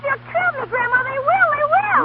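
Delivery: very high, desperate, near-hysterical pleading; frantic begging. Acted. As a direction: beg for your life, voice cracking high. Girl begs not to go back.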